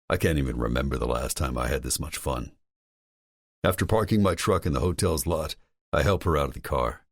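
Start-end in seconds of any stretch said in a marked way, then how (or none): none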